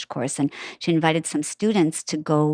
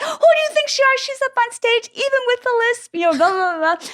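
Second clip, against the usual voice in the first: put-on much higher, fired-up mother defending her child; indignant mother. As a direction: tell off the judge like a protective mom. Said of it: "My mother ... is like".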